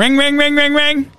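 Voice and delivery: phone ring imitation. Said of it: "All right, phone rings".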